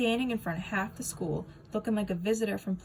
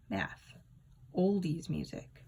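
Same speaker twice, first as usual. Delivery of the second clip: ordinary delivery, nothing marked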